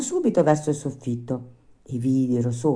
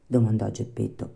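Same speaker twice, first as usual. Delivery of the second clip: plain reading voice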